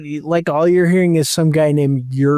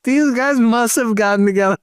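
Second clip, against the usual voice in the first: higher, fast, breaking into laughter; can barely talk for laughing; laughing while talking; 6 semitones higher, much faster than usual. Real, not acted. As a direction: laugh through the words. Cracks up over the names.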